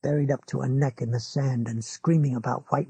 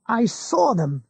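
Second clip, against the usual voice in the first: lightly raised, insistent; Ralph insisting; 7 semitones higher, 3 dB louder, slower than usual. A narrator's reading. Ralph insists to his neighbour, mildly urgent. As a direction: press the point a touch higher.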